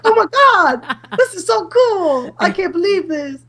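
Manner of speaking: high-pitched, giddy fangirl reenactment; hammed-up squeal of amazed delight; starstruck gushing. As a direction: replay being thrilled, pitch shot way up. Retells own starstruck reaction to guru.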